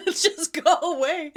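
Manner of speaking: a high, laughing go away. Retold or imitated. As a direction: laugh it out while shooing them. Laughing retort after polite dismissal.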